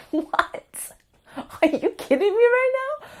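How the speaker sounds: high, fast, incredulous squeal; shocked disbelief. Retold or imitated. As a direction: replay the moment the news floored you. Her shock at being dilated.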